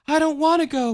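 put-on high childlike plea, mildly tearful; girl begging not to leave; crying girl. A narrator's reading. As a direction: plead like a small girl. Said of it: "Michelle cried".

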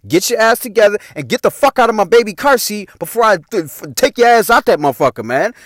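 exasperated order. Retold or imitated. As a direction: retell a fed-up threat. Acts out ordering friends from seat.

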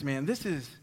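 usual speaking voice

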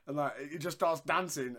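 normal talking voice

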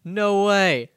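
loud, high burst of disbelief; astonished. Real, not acted. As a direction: blurt it out, stunned by the news. Surprised at learning the trade fact.

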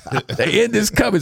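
laughing while doing the voice; [name] voice. Retold or imitated. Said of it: "Nick V would do a [name] impersonation".

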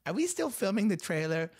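put-on high, breathy old lady; quavering old lady question. [name] asks, out of scene.

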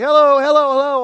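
loud high "Hello, hello, hello"; mock calling out. Preacher mocking himself calling hello to the congregation, loud. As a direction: call it out like into a dead line. Mocking phone greeting repeated.

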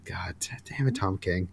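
sighing, whispered, worn-out curse; exasperated sigh. Real, not acted. Podcaster sighing in real frustration at Tom King's writing, whispered. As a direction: breathe it out, fed up and defeated.

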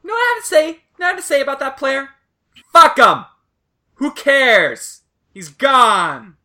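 high, loud ranting outburst, building to a shout; hammed-up high-pitched shouting rant; VA impression. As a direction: imitate an absent co-host blowing up. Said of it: "That's my V A impression".

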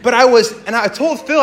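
usual speaking voice

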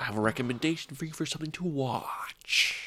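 very soft, deliberate repeat; John Malkovich. Shown as a demonstration. Host showing co-host how he said it, soft and deliberate. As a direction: repeat it hushed and measured, oddly precise. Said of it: "You say it like John Malkovich".

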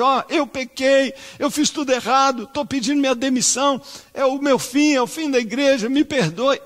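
high, loud, anguished pleading; pleading confession. Pretend. Pastor imagining his confession to the church, anguished and pleading. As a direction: beg the church's forgiveness in anguish.

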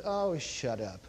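low, soft weary brush-off; muttered mock put-down; mock dismissal. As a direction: drop it low, fed up with whining. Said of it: "It's what he said".

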